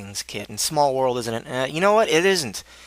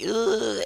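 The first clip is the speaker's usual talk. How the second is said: high exasperated ugh; disgusted ugh; 11 semitones higher than usual. Retold or imitated. Shrugging off the smiling guy with a high ugh. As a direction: let out a fed-up groan. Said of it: "it was like".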